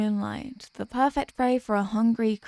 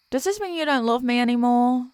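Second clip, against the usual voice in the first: ordinary talk, nothing marked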